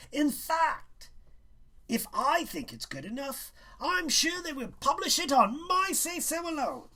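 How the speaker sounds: high, loud and haughty schoolmaster; condescending teacher lecturing a student; pompous teacher. A narrator's reading. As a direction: talk down to the boy as a smug teacher. Teacher voiced in story.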